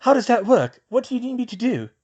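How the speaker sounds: lightly voiced keen boy; eager boy's voice. Colin asking about the job, as an eager boy.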